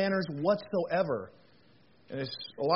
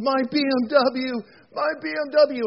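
distraught wail; 7 semitones higher, 5 dB louder than usual. Retold or imitated. Banker over the ravine at his wrecked car, mock-distraught wail. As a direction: wail it like a prized possession just went up. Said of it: "looked down and says".

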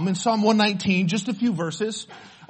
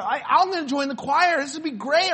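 higher-pitched, mock-eager younger self; upbeat and keen, pitched up; 7 semitones higher than usual. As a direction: retell it with naive enthusiasm. Retelling his eagerness to join choir.

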